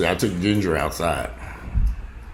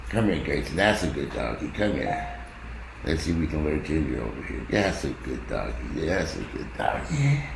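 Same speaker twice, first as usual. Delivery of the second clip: softer, lower coaxing voice for a dog; 5 semitones lower, 7 dB quieter than usual. Real, not acted. Owner luring a stubborn dog over, soft coaxing. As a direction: lure the dog gently.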